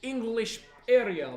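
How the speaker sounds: slow, put-on Russian accent; mock Russian accent. Teasing co-host, slow hammed-up Russian accent. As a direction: lay on a fake Russian accent, slowly. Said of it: "when you speak a Russian".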